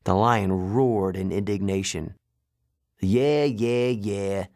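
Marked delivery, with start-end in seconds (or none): 3.0-4.5 trucker brushing off a complaint; put-on dismissive trucker voice. Trucker to the roaring lion, dismissive. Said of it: "the man said".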